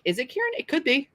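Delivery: ordinary delivery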